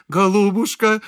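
high, loud, touched endearment; tearfully fawning. A narrator's reading. Priest fawning on the lady of the house, touched. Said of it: "умилился отец Фёдор".

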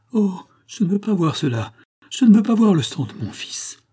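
high, rising cry of dread; anguished protest. Acted. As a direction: plead not to watch your child bleed. Mother dreads seeing son bled.

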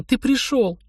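высокий голос обрадованной девушки; скажи высоко, будто не веришь своему счастью; растроганное бормотание. A narrator's reading. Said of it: "бормотала она". (растроганно, высоким голосом)

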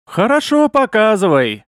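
high, loud eager agreement. Acted. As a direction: jump at it gladly. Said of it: "охотно согласился Овар".

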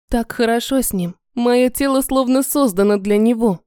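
usual speaking voice

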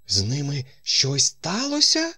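high, alarmed question; a touch of fright, pitch leaping up; frightened exclamation. A narrator's reading. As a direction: ask it in mild alarm, climbing high. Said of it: "злякано вигукнула міс Гельєр".

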